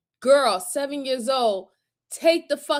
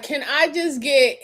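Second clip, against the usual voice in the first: ordinary talk, nothing marked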